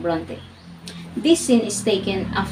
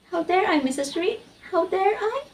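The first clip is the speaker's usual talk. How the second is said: incredulous questions, rising higher on the repeat; indignant echo; 11 semitones higher than usual. Acted. Jane throws her aunt's challenge back, indignant.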